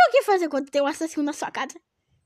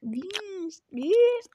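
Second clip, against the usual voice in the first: whisper; 9 dB quieter, slower than usual. Acted. Whispering word.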